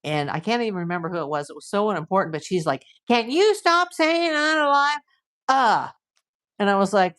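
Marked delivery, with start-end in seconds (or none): 3.1-5.9 higher-pitched mocking complaint; mock exasperated. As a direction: imitate a fed-up commenter, pitched up. Mimics a commenter complaining at her.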